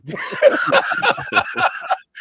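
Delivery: high, loud burst of laughter. Real, not acted. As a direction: howl with laughter. Redacted thanks list cracks him up.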